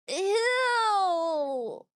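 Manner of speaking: long, high cry of disgust; real drawn-out disgusted squeal. Real, not acted. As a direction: drag out a loud ew in revulsion. Disgusted reaction to men being dropped.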